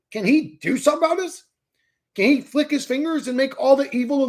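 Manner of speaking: high, loud indignant shouting; furious rant. Real, not acted. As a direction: rail at God with rising outrage. Rant against God.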